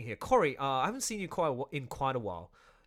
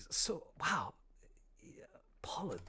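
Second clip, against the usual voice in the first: whispered, softer wonder; awed whisper; 3 semitones higher, 8 dB quieter, slower than usual. Real, not acted. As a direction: breathe it out in quiet amazement. Amazed aside after Poland.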